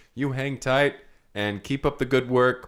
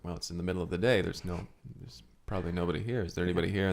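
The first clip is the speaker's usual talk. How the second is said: softer, lower cautious call; hushed; 6 semitones lower, 8 dB quieter, faster than usual. Acted. Detectives knock at the empty theater door, hushed and low.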